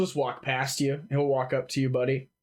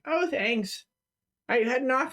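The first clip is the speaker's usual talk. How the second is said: much higher woman's voice; wife's voice; 10 semitones higher than usual. Acted. Wife thanks husband for water, high-pitched voice.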